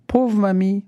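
high, drawn-out pitying cry; exclaiming. A narrator's reading. Petit Pierre to the flattened witch, exclaiming. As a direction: exclaim like a concerned little boy. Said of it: "Petit Pierre s'écrie".

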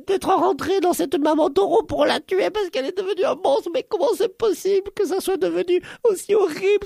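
very high-pitched lamenting kitten voice; chaton horrifié. Acted. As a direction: wail theatrically, stretching the words. (petite voix aiguë de chaton qui se lamente)

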